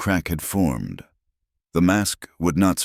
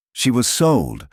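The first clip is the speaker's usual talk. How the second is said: higher, stressed correction on "sold"; pointed correction; 7 semitones higher, 4 dB louder than usual. A narrator's reading. An onlooker corrects another about Zahira's fate, pointedly. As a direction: correct them with a raised emphasis. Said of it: "another corrected".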